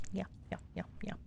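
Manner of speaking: breathy whispered yeah yeah yeah. Real, not acted. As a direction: murmur it under your breath. Soft aside to departing viewer.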